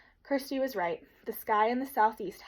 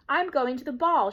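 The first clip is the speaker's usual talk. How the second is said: higher and louder, playing dress-up; child's pretend ball voice; 5 semitones higher, 7 dB louder than usual. A narrator's reading. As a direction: announce it grandly like a play lady. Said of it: "in a high pretend voice".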